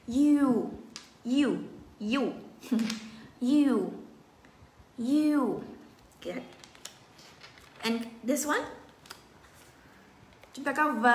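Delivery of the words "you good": ordinary delivery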